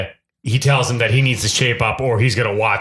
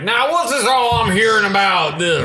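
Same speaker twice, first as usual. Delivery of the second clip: mocking president voice; 10 semitones higher than usual. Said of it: "He's just like".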